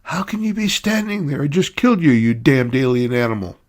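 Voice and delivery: can't believe the man is standing; startled indignant shout; shocked outburst. Acted. Commodore confronts the man he shot.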